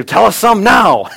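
put-on high, loud, slow childish plea; eager kids begging. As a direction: beg like an excited little kid. Kids begging father for story.

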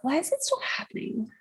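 put-on high rising Valley Girl question; Valley Girl, tune swooping up at the end; Valley Girl uptalk. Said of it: "With the, with the inflection at the end?".

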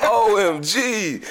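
stunned. Real, not acted. Host stunned by the joke.

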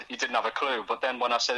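ordinary delivery

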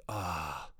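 soft longing sigh; soft wistful ah while watching them plan. Retold or imitated. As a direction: sigh with adventure envy. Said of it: "I'm just like".

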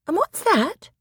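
put-on high, curious little girl. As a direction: ask like a wide-eyed child. Lucy points ahead.

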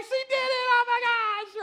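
high, loud, overjoyed outburst; reenacted thrilled, emotional squeal; all emotional. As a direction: cry out as an amazed proud parent. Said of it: "I'm all emotional".

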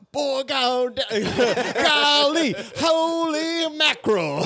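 high, loud cartoon Texan outburst; over-the-top Texas gun guy; Yosemite Sam. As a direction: holler it high and stretch the last words. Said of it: "Oh, like Yosemite Sam".

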